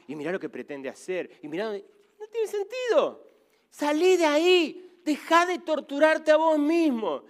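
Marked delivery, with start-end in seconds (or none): 2.2-7.2 higher and louder, slow, pressing the listener; urgent plea. Real, not acted. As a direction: call them out of it with force. Preacher urging listener out of resentment.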